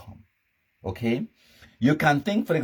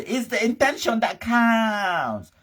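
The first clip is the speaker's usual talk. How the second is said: high, slow, drawn-out mock whine; hammed-up high wail, dragging the last word; mocking child's excuse; 10 semitones higher, slower than usual. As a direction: stretch it out high, mimicking a feeble excuse. Mimics the useless child's excuse.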